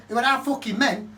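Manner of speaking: normal talking voice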